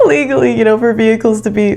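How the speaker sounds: real amusement bubbling through speech; talking through laughter. Real, not acted. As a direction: push the words out while still laughing. Talking through own laughing fit.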